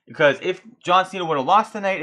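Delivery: normal talking voice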